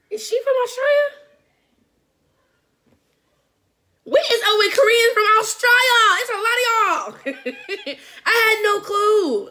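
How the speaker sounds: realizing with a jolt; surprised. Real, not acted. Reactor surprised the idol is from Australia.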